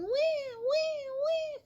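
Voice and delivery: high mock baby wail; imitated baby crying. Storyteller mimics the baby Pharaoh's daughter heard, high mock crying. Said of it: "she heard a baby crying".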